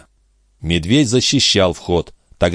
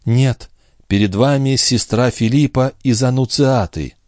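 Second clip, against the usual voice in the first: higher-pitched, sorrowful declaration; 6 semitones higher than usual. A narrator's reading. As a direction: reveal yourself with restrained grief. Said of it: "сколько скорби звучало в её голосе". (скорбно)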